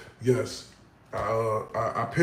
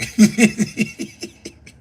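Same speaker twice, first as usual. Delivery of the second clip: breathy laugh; 7 semitones higher, 5 dB louder than usual. Real, not acted. Laughing at [name]'s rhyme.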